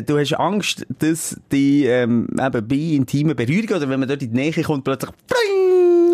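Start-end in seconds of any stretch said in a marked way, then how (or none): 4.8-6.2 sudden high squeak on pling; high squeaky pling. Acted. Comic sound effect word.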